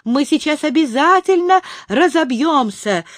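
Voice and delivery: high-pitched panicky cry; alarmed shout. A narrator's reading. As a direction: wail that a crash is certain. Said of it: "закричал он".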